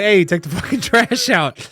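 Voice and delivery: high, loud yelling mom impression. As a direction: bark it out like an angry mom. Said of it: "I was gonna do an impression of my mother".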